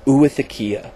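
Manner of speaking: Oothekia said "oo-ith-uh-KEE-uh", falling at the end; enjoy each syllable of Oothekia; relished pronunciation. Shown as a demonstration. Vlogger showing a fun word to say, relished Oothekia. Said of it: "that is fun to say".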